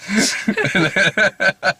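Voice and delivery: tiny high squeak, drawn out; slow, laughing miniature voice; tinier voice. Shown as a demonstration. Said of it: "the voice gets tinier and tinier every time".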